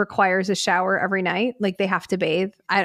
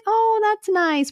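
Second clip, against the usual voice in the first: mock polite; 12 semitones higher, slower than usual. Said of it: "not just like".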